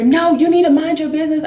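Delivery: high, loud indignant retort; mock yelling. Mother with baby scolding another passenger, mock yelling. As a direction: imitate a quarreller shouting someone down. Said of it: "is yelling and screaming".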